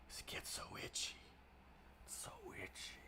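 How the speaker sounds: whispered complaint. Real, not acted. Streamer complaining about an itchy beard, whispered. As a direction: breathe it out in a whisper.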